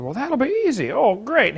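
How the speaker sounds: high-pitched, lightly acted delight; mock-cheerful, swooping up high; breezy confidence. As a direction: play a son relieved at an easy task. Son gladly accepts mother's easy task.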